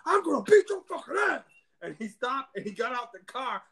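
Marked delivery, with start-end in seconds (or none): 0.1-1.4 high, loud mock threat; threatening yell. Raging driver threatening a fight, high mock yell. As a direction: yell it like a hothead. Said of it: "he's like".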